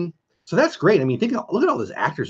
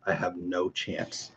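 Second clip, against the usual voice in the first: hushed, slowed admission; 7 semitones lower, 14 dB quieter, slower than usual. Real, not acted. Streamer confessing to chat that Rudy makes her cry, hushed and slow. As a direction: drop almost to nothing on "I have no chance".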